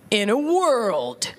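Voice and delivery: slow, dramatic trailer announcer; movie trailer voice. Retold or imitated. Mocking every movie trailer with the announcer voice. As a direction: draw out each word like an epic preview. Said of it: "here's my impression of every movie trailer".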